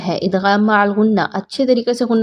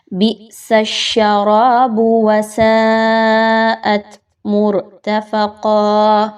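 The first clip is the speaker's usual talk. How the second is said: slow chant with a firm ha in كَالْمُهْلِ; tajweed recitation; much slower than usual. Shown as a demonstration. Teacher modelling the verse for students, slow chant. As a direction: recite slowly, ha fully pronounced. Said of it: "ہا کے مخرج کو ویک نہیں ہونے دینا".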